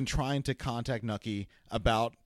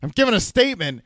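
loud, high, fast tossed-off line; mock deadpan Al; 9 semitones higher, 9 dB louder, faster than usual. Co-host retelling Al beating the reporter, loud fast mock boast. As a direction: shout it cheerfully mid-beating. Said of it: "Al just is like".

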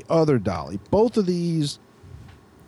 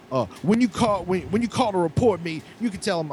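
high-pitched imitation of a parting challenge; mock defiance; 7 semitones higher than usual. Worker dares the man to report him, mock defiant. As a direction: needle them lightly in a raised pitch.

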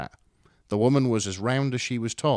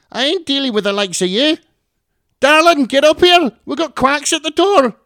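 much higher and louder, yapping terrier voice; grating Scottish mum; 15 dB louder than usual. Acted. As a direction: yap it like a cranky little terrier. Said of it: "Her voice was grating".

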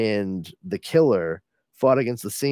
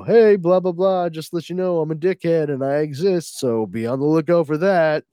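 higher and louder, cocky sing-song taunt; smug villain; 6 semitones higher, 5 dB louder than usual. Retold or imitated. Rictus mouthing off to the reader, smug taunt. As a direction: toss it off like a smug jerk. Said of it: "he's like".

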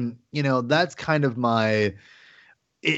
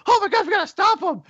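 hammed-up alarm; faster than usual. Mock heroes reacting to villain.

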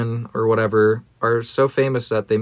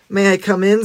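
put-on polite boy voice; 8 semitones higher than usual. Joey's line repeated.

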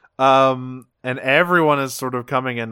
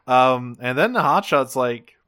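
ordinary talk, nothing marked

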